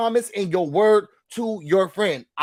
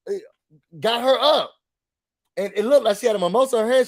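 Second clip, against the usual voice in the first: normal talking voice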